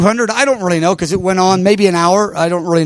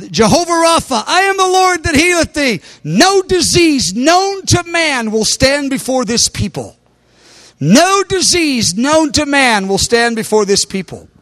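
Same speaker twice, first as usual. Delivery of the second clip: prophetic declaration; 6 semitones higher than usual. Retold or imitated. Preacher relays the Lord's word to the people, prophetic declaration.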